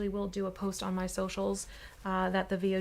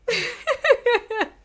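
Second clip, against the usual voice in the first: high laugh; 9 dB louder than usual. Real, not acted. Gleeful laugh after evil plan.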